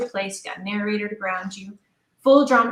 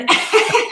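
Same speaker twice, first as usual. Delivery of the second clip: sudden high unvoiced laugh, loud; burst of laughter; 12 semitones higher, 8 dB louder than usual. Real, not acted. Laughing at how old she feels. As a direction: laugh out at herself.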